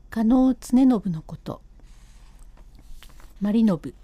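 plain reading voice

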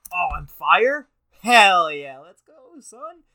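loud, rising whoop of delight; hyped cheer. Real, not acted. Learning Aries is a fire sign, cheering loudly at his screen, excited. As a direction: celebrate like you just won. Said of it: "finds out he's fire sign".

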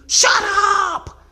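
high, full-force acted shout; screamed command. Husband cutting off his apologizing wife, screaming. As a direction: scream it as an enraged order. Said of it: "And he screams".

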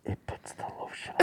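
thin high whisper; creepy high whisper. Retold or imitated. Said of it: "the guy from The Silence of the Lambs".

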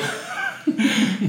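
high, voiceless wheezing laugh; breathy giggle. Real, not acted. Cracking up while retelling her reply to him, high wheezy laugh. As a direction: crack up mid-word.

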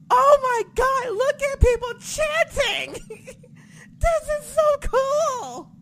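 very high, loud gushing squeal; thrilled reaction. Retold or imitated. Fan hearing people chanting, high thrilled squeal. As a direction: squeal it slowly, overjoyed and awestruck. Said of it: "I was like".

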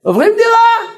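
very high, loud declaration; excited announcement. Retold or imitated. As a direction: proclaim the move with a burst. אמא מכריזה על מעבר דירה. (הכרזה נרגשת בקול גבוה מאוד)

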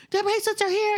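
put-on high-pitched excited kid announcing news; excited child. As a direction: imitate a thrilled child, voice way up.

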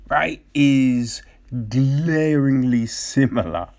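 usual speaking voice

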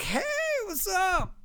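high, drawn-out friendly greeting; feigned familiarity. Retold or imitated. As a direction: greet a stranger like an old pal. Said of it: "being surprised, I'm always like".

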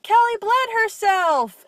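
high, loud, slow taunting shout to the whole class; mocking yell. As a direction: broadcast her shame to the room.

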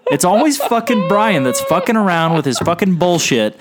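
loud, high comic rant; mock outrage. Comic rant blaming Brian.

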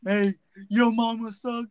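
hammed-up taunt down the line; dumbass prank caller. Imitating a prankster wasting police dispatchers' time, dumbass taunt. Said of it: "listening to your dumbass saying".

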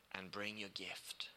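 sudden hushed whisper. Acted. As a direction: drop to a breath to end the verse. Scripture ending dropped to whisper.

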